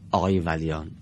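normal talking voice

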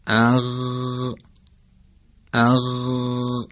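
long-held throaty "اغ"; vowel, then a sustained voiced gh in "اغ"; demonstrating ghayn. Shown as a demonstration. Teacher showing where ghayn is articulated, drawn-out demonstration. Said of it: "که مخرج غین و".

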